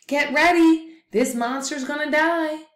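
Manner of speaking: high, loud call to the group; rally them, urgent and loud. Acted. Coyote calls the animals to get ready, high and loud.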